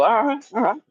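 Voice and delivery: high-pitched mock-defensive voice; defensive placating. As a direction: act out fending someone off. Reenacts his defensive response.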